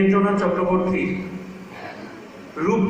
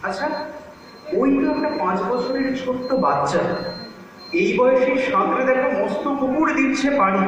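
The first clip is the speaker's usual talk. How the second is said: higher-pitched, insistent verse argument; arguing grandparent, indignant; 5 semitones higher than usual. Acted. Grandparent argues the child needs no city school, indignant. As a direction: build a list of proofs with rising exasperation.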